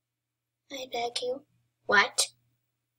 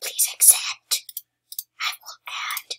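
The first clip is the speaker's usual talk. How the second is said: whispered plea. Real, not acted. Gamer begging a trade partner, whispered. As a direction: whisper please accept.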